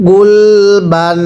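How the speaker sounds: গুলবান stretched very slowly, ending on a dental n; teacher modelling গুলবান slowly for students; slow drawn-out reading. Shown as a demonstration. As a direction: read গুলবান syllable by syllable, slowly, with a clear final n. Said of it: "আবারও পড়বেন".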